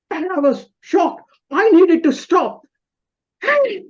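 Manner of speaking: high, loud, urgent storytelling; shocked retelling. Retold or imitated. Driver telling how the car ahead suddenly turned, shocked.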